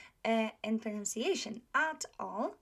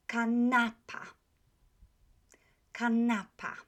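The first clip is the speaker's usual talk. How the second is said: "kanapa" with the middle "na" stressed and held; penultimate stress; 3 dB louder than usual. Shown as a demonstration. Teacher showing where the accent falls, stressed middle syllable. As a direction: lean on the "na" in "kanapa". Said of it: "on the next to the last".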